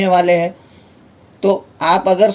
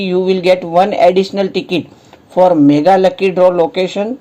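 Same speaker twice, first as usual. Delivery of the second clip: slow reading aloud; much slower than usual. Real, not acted. Reading English offer text slowly.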